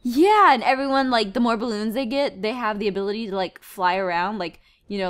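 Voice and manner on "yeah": high, loud, eager yes; acted enthusiastic agreement; delighted acceptance. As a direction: burst out with a happy yes. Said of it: "And you're like".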